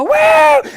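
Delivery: high, loud whoop; kids' cheer. Retold or imitated. Kids cheering as they stamp their passports, loud whoop. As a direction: imitate a crowd of kids whooping. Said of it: "And kids go".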